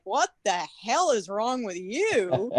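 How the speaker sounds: slow, drawn-out incredulous question; mock disbelief. Baffled friend questioning a crying fan, drawn-out disbelief. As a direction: stretch each stressed word in bafflement.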